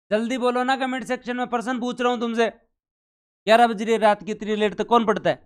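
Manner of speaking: high, insistent prodding; urging the class. Real, not acted. Teacher prods late-night students in the comments, high and urgent.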